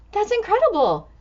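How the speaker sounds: ordinary delivery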